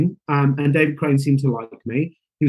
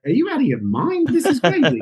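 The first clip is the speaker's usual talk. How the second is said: higher, mock-disbelieving outburst; incredulous; 9 semitones higher than usual. Actor hearing of Spider-Man auditions, higher mock disbelief. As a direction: play the disbelief up, half laughing.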